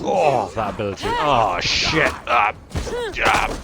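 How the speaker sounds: loud startled cries; panicking loud exclamations; alarmed yelling. Real, not acted. Surprised by game combat.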